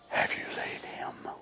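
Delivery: a soft hushed whisper, barely voiced; whispered question. Jesus whispering to the sisters, a soft hushed question. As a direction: breathe it out gently. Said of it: "And said".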